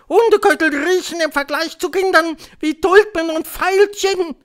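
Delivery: very high, loud screech; ranting witch. A narrator's reading. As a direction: proclaim it like a witch before a crowd. Witch ranting to her audience. (zeternde Hexe, hoch und laut)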